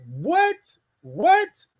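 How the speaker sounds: high-pitched repeated what; delighted disbelief at a viewer's comment; surprised what. Real, not acted. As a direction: leap up high, thrilled disbelief. Excited reaction to comment.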